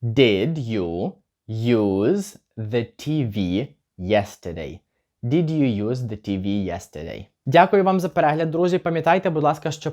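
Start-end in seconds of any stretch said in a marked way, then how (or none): 0.0-4.8 very slow, drawn-out question; very slow model. Shown as a demonstration. Teacher modelling the question very slowly for learners. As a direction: stretch every word for the class. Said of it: "чи використовував ти телевізор вчора".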